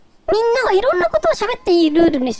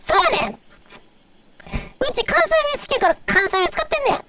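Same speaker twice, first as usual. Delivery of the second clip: put-on Kansai dialect, a little higher; 6 semitones higher than usual. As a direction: play a Tokyo native faking the Kansai dialect. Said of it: "関西弁好きだから、普段から関西弁使ってんのよ".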